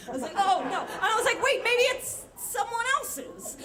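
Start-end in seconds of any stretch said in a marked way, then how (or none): 0.4-0.8 loud, higher-pitched alarm; mock dismay. Narrator hearing guard report Subaru on fire, mock dismay. Learns the burning car is spotted. 1.4-3.6 very high, slow wishful realisation; hopeful denial. Retold or imitated. Narrator denying the burning car is hers, high hopeful denial.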